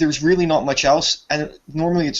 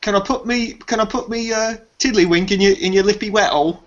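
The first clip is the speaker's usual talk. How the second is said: a lightly voiced English bloke, pitched higher; higher, cheeky put-on British voice; mock British accent; 6 semitones higher than usual. Teasing the stream as a crude Brit, mild British accent. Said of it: "You sound like fucking Carl from the fucking Ricky Gervais show".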